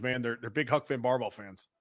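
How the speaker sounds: ordinary talk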